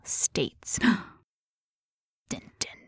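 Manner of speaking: dramatic ominous whisper. Pretend. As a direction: whisper the teaser with suspense. Dramatic teaser.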